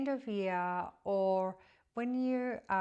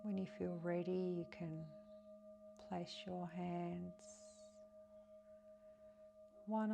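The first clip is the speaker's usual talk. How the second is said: softer, calm guiding voice, phrase by phrase; guided meditation; 2 semitones higher, 6 dB quieter than usual. Acted. Guiding listeners through breath and a pier visualization, meditative.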